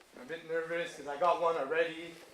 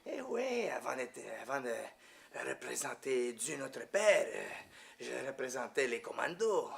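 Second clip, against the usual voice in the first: put-on priest's French accent; 4 dB quieter, slower than usual. Retired commando priest telling his past, imitated French accent. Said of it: "the way he talks with that French accent".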